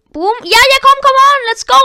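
high, loud gamer cheering; excited cheer. Real, not acted. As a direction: yell it out as you score. Scoring a goal, cheering.

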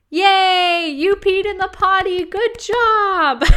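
put-on high, loud cheering at a toddler; potty training praise. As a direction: gush over a toddler's potty win.

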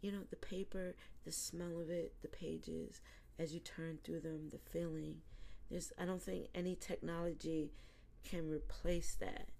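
softly, almost whispering about books; hushed reverie. Retold or imitated. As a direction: breathe it out like a cherished secret. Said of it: "And I'm like".

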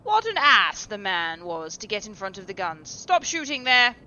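loud angry call across the field; shouting. A narrator's reading. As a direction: yell to stop the shooting. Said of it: "he called at the top of his voice".

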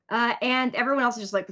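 normal talking voice

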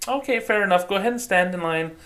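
high-pitched customer-service voice; bank staffer. Acted. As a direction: put on a high, obliging bank voice.

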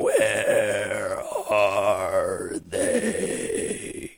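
very slow, soft zombie moan. Retold or imitated. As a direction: stretch each word out like the undead. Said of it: "there is a zombie voice going".

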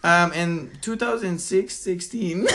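mock him groping slowly for the number; imitating struggling speaker. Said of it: "But he's like".

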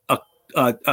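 normal talking voice

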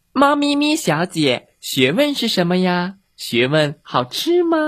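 high, squeaky chick voices clamouring; chattering chicks. Acted. Chicks pestering their cat teacher, high and squeaky. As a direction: pipe up like noisy little chicks. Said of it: "叽叽喳喳地嚷开了".

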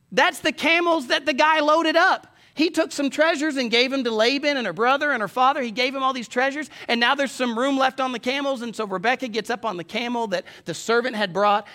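high, loud, urgent sermon delivery; forceful preaching. Real, not acted. Preacher urging a congregation not to quit God, forceful.

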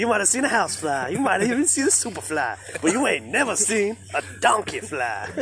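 a light pass at Donkey's sing-song patter; Donkey quote. Retold or imitated. Friends riffing on Shrek, lightly voicing Donkey. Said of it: "He's a flying talking donkey".

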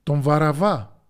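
loud, slow shout of the name; the mob roars back at Pilate, loud and slow; crowd shouting. A narrator's reading. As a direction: yell it like an angry mob. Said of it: "Και το πλήθος φώναξε".

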